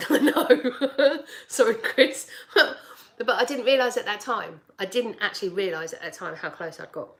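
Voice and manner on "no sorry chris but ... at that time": laughing. Real, not acted. Laughing apology to Chris.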